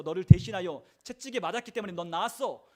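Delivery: loud, emphatic proclamation of healing; forceful declaration. Acted. Preacher voices Christ's promise of healing, forcefully declaring. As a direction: proclaim it as a settled fact, full voice. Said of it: "라고 말하는 이 선언".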